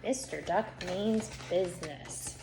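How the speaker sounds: plain reading voice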